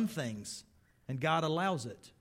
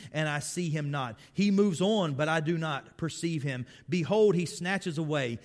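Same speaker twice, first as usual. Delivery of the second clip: loud, soaring preaching of scripture; preaching Job; 2 semitones higher, 4 dB louder than usual. Acted. Preacher voicing Job's lament to the church, full force.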